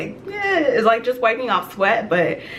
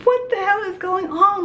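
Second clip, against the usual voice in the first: mock freak-out, pitched way up; retold panic; 11 semitones higher than usual. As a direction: replay your panicked thought in a much higher voice. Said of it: "I'm panicking".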